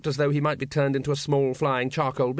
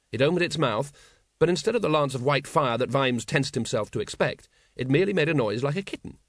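plain reading voice

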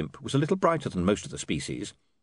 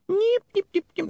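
very high squeaky nipping; recording stuttering; high imp glitch chatter; imp skipping noise; 3 dB louder than usual. A narrator's reading.

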